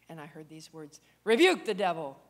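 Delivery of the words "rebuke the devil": commanding voice. Retold or imitated. A voice heard from God.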